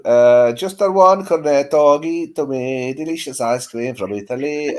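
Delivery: drawn-out Italian ice-cream patter; hammed-up Italian accent. Shown as a demonstration. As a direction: put on a playful Italian accent. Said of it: "Italian accent".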